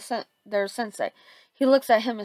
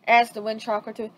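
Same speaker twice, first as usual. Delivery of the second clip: ordinary talk, nothing marked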